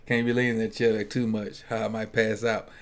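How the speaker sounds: ordinary talk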